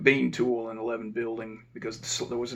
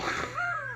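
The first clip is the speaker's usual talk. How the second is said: high short laugh; 7 dB quieter than usual. Real, not acted. Laughs at own blunder.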